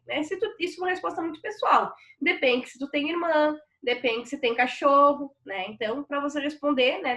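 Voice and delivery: usual speaking voice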